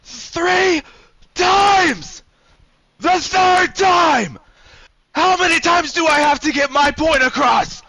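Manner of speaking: high, full-force scream, words drawn out. Acted. Game master as man demanding to be understood, screaming at full force. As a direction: scream at the top of your lungs. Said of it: "screaming at the top of his lungs".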